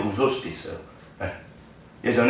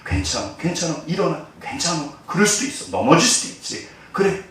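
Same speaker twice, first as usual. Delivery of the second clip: soft, hushed reassurance; calm a child who has fallen; God softly comforting a child who fell down; soothing comfort; 5 dB quieter than usual. Acted.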